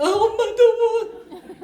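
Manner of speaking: high, loud, drawn-out mock whine; nagging child. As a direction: stretch it into a child's demand. Child nags mother after promising.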